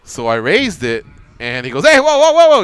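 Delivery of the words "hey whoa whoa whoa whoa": high, loud panicked whoas; startled yelp. Retold or imitated. Buddy yanked up on forklift forks, panicked high yell. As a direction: yelp as the forklift jerks him up.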